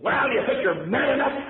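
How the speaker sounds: high, loud mock challenge; retold taunting dare. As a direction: imitate a sailor pressing the kid hard. Sailor repeats the dare louder.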